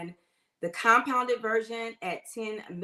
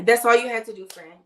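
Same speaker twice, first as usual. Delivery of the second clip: louder, punching all; emphatic reassurance; 11 dB louder than usual. Real, not acted. Host reassures LaShante about the bio link, emphatic. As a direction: tell her it was that easy. Answering a viewer in chat.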